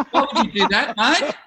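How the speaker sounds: mock Australian accent, high and loud, through laughter; a puzzled Aussie punter, hammed up with a laugh; Australian audience. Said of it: "just sort of like".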